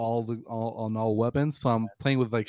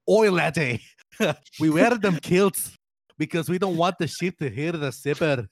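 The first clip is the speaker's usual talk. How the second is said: hammed-up Scottish accent, higher and louder; awful Scottish accent; 5 semitones higher, 3 dB louder than usual. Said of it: "for that awful accent".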